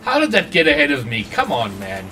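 ordinary talk, nothing marked

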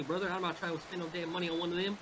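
ordinary delivery

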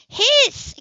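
sudden high loud outburst; blurted cry. Acted. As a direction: let it burst out before thinking. Came out of her mouth.

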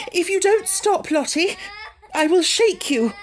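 high, loud scolding threat; threatening. A narrator's reading. Miss Amelia losing patience with kicking Lottie, scolding. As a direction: snap at the child. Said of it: "then in quite another tone".

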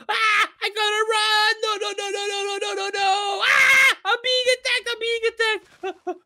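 high, frantic cries of alarm; panicked screaming. Acted. Player under attack by a giant reptile, panicked. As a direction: yelp and babble in high-pitched terror.

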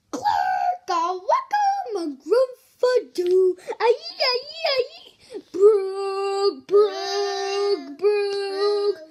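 loud, high wailing gibberish; goofy nonsense noises. Acted. Reader making silly sounds for the child, loud wailing gibberish. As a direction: howl the gibberish like a silly beast.

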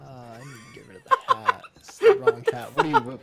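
high, loud laughing speech; words breaking into real laughter; helpless laughter. Real, not acted. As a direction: talk while cracking up. Cracking up over the character's hat.

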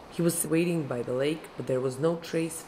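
plain reading voice

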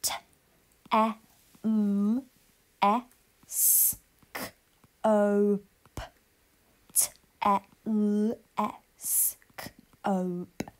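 teacher sounding out letters slowly; Fred talk. Shown as a demonstration. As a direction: sound out each letter separately. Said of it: "let's Fred talk".

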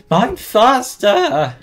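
high, loud, slow plea; will the block to break; desperate urging. Real, not acted.

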